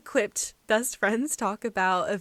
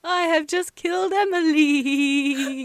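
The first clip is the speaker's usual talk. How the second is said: spooky voice; 3 semitones higher, 6 dB louder, slower than usual. Pretend. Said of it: "I was trying to be spooky".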